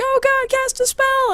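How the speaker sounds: very high, drawn-out plea; mock panicked urging. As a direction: imitate a panicking group begging. Everyone urges her to cast.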